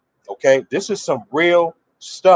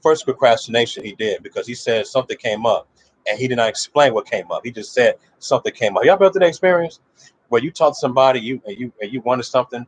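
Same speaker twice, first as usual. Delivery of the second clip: ordinary talk, nothing marked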